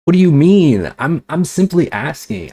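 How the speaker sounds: high, loud indignant snap; indignant retort. Retold or imitated. Answering roommate's accusation, loud and indignant. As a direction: fire it back, outraged.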